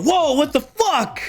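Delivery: high-pitched, shocked yelp; startled outburst. Acted. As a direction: play someone jolted by a sudden gunshot. Characters startled by gunshot.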